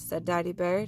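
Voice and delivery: ordinary delivery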